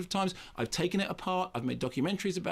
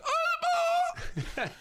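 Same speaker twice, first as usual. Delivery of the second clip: high squeal of the name, half laughing; startled yelp; 3 dB louder than usual. Retold or imitated. Nervous young interviewer startled by Linda Blair, high yelping squeal. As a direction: yelp it out in flustered shock. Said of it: "and I went".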